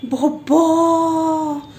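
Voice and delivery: put-on slow, drawn-out Garhwali tailor's accent. Tailor teasing the vlogger about her clothes, slow Garhwali accent. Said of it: "ऐसे बोल रहे".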